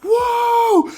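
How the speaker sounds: high, loud excited crowd shout; astonished fan's high cheer. Retold or imitated. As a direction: yell it like a hyped fan in the stands. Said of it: "everybody's like".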